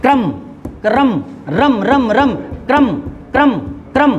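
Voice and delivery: क्रम said with k and r joined, "kram"; pronouncing kram. Shown as a demonstration. Teacher drilling students on saying क्रम as "kram". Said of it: "रम बोलेंगे".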